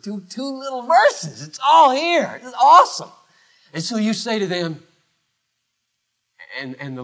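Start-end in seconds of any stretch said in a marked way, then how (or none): 0.0-3.1 rising, laughing with delight; real joyful excitement. Real, not acted. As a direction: marvel at the little verses. Preacher delighted by the verse.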